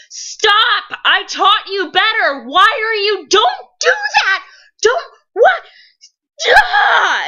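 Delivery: hysterical high-pitched shouting; Ghost Balthazar. Acted. Ghost Balthazar watching his brother, screaming in frantic despair. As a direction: scream helplessly at a brother who can't hear. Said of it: "Ghost Balthazar is watching his brother with Ghost Gabriel".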